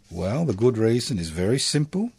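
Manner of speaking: ordinary delivery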